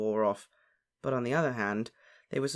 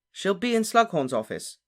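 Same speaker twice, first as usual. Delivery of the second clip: higher, louder, sure assurance; confident; 6 semitones higher, 5 dB louder than usual. A narrator's reading. Harry lures lovesick Ron along, confident. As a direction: state it with full confidence. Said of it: "Harry said confidently".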